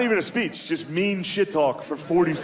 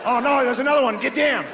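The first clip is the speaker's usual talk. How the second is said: much higher, panicked Australian voice; mock Australian alarm; Australian accent; 10 semitones higher than usual. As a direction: yell it as a jokey Aussie in the office.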